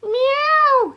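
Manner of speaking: high groaning meow; groaning cat meow. A narrator's reading. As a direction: meow like a grumpy hungry cat. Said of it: "he groaned to himself".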